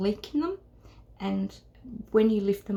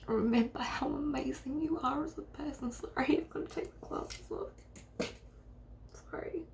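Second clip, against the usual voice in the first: real choked-up encouragement, trailing into a quiet sorry; close to tears; 4 semitones higher, 4 dB quieter than usual. Real, not acted. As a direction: voice rising and fading as tears well up. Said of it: "I feel like I'm gonna cry".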